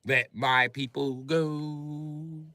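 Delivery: slow, intoned like Moses; solemn biblical voice. Retold or imitated. Quoting Moses from the Bible in a slow intoned voice. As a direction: draw it out like a prophet. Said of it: "let's talk about what your Bible said".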